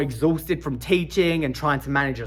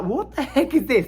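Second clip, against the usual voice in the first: higher, panicky question to the wife; mock panic; 9 semitones higher than usual. Showing his wife the package in mock panic. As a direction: play up the alarm, pitched high. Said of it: "Panicking, I'm like".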